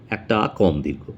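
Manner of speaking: usual speaking voice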